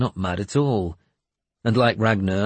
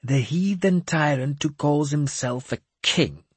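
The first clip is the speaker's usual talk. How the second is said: higher, scornful; 6 semitones higher than usual. Acted. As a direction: sneer at the heathen. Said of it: "Jeremias said scornfully".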